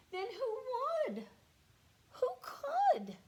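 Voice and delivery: high, slow, crying plea; dramatic despair. Acted. Farmer at wit's end, wailing in mock despair.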